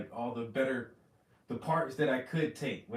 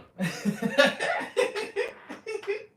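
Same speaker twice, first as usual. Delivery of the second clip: high, loud laughing fit; high cackle of real laughter; 9 dB louder than usual. Real, not acted. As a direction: burst out laughing at the memory. Laughing at frustrating his mentor.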